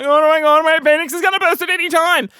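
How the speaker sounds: high, fast panicked babble; mock panic. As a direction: play up frantic alarm. Acts out panic about appendix.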